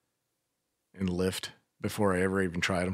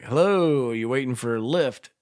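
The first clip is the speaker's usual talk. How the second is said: higher, louder singsong greeting; friendly driver; 3 semitones higher, 4 dB louder than usual. Retold or imitated. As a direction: imitate a cheery driver calling out. Voices friendly driver greeting passengers.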